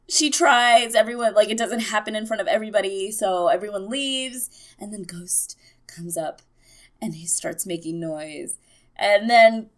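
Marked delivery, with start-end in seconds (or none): 4.8-8.6 soft unvoiced hush; breathless hush before the reveal; suspense whisper. Real, not acted. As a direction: whisper it like the big moment is near.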